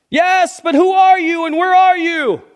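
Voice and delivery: very high, loud confused call; high puzzled shout. Acted. Jack voiced in joke.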